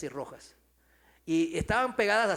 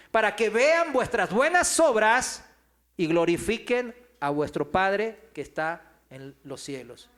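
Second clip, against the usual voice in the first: scripture reading; 3 semitones higher, 9 dB louder than usual. Acted. Repeats scripture, preaching.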